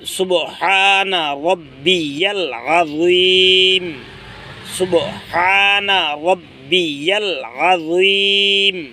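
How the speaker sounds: drawn-out chant of সুবহানা রাব্বিয়াল আযীম; slow recitation. Shown as a demonstration. Teacher showing listeners how to recite in ruku, slow chant. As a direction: recite it slowly, stretching each word. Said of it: "এভাবে পড়তে হবে".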